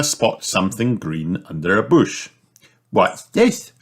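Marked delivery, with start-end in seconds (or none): 2.9-3.7 put-on high, curious little girl; puzzled, high-pitched question; Peppa. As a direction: ask in a high child's voice. Peppa spots something, asks.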